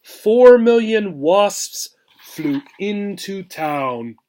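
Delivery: lively storybook read-aloud, rhythmic and bouncy; expressive picture-book reading; reading to children. Acted. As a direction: read the rhyme to a class with relish. Teacher reads picture book aloud.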